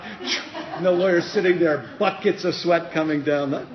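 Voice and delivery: loud, high, amused telling; laughing. Real, not acted. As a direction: tell it while laughing. Laughing narration.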